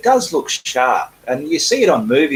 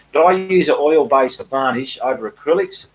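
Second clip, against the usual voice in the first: ordinary talk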